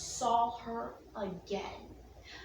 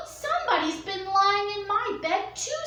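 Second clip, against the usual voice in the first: medium bear voice; 5 semitones higher, 4 dB louder than usual. Acted. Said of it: "said the medium-sized bear".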